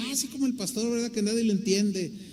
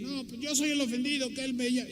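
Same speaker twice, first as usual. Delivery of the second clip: mock offended whine; 5 semitones higher, 4 dB quieter, faster than usual. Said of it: "mocking an offended person".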